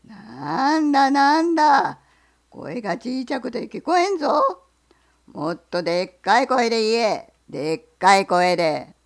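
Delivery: loud, rough bellow from behind the rock; booming bear. Acted. Bear shouts at the fox from the rocks, a loud bellow. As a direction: roar it out like a big bear. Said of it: "ガンガラ声がしました".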